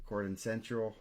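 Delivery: ordinary delivery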